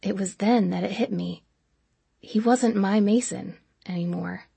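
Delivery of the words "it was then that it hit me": ordinary delivery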